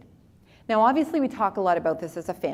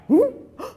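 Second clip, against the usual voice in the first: sharp high gasp of excitement; sudden thrilled gasp; excited gasp; 14 semitones higher, 6 dB louder than usual. Retold or imitated. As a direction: gasp like a dog hearing its name. Dad hears the truck.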